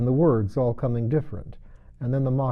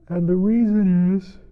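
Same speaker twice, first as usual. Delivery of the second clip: sleepy yawn; 9 semitones higher, 7 dB louder than usual. A narrator's reading. Mock Turtle yawning mid-explanation to Alice. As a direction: trail off into a yawn. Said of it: "the Mock Turtle yawned".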